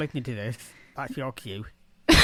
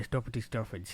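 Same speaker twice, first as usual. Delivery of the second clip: "stuffity stuffage" with rounded northern English vowels; northern English accent; 6 dB quieter than usual. Shown as a demonstration. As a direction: demonstrate northern English pronunciation, softer. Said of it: "We don't".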